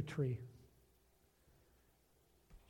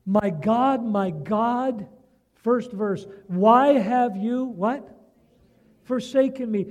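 loud and slower, each phrase hammered out; loud preaching reading; 4 semitones higher, 10 dB louder, slower than usual. Shown as a demonstration. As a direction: read the cry of the psalm aloud with full force to the congregation. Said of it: "I'm gonna read it to you 'cause this is so important".

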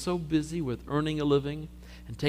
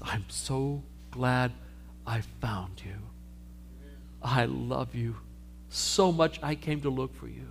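tender; 3 semitones lower, 6 dB quieter, slower than usual. Acted. Jesus tenderly finding lost sheep.

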